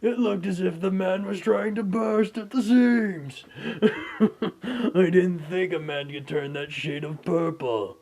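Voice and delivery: loud, high and laughing through it; laughing, gleeful. Acted. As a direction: cackle through the gossip. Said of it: "laughing their asses off, going".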